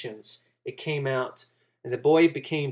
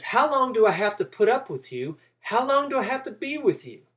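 exasperated Jesus; 7 semitones higher than usual. Acted. Jesus rebuking disciples in sermon.

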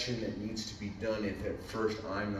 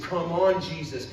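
heartfelt call, raised but not cheering; earnest plea; 7 semitones higher, 8 dB louder than usual. Shown as a demonstration. Showing what he really means, an earnest plea to Jesus. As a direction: plead it sincerely, falling on the name. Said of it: "What I'm saying is".

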